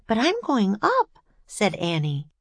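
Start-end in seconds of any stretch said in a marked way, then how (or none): 0.1-1.2 put-on high, determined child voice; eager little girl. A narrator's reading. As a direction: announce your plan in a high voice. Girl announces daring plan.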